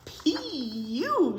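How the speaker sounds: a little fly going pee-you; a hint of a disgusted fly. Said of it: "said the fly".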